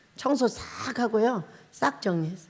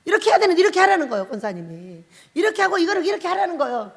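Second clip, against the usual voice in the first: mock nagging; 5 semitones higher, 3 dB louder, faster than usual. Mimicking the deaconess's nagging.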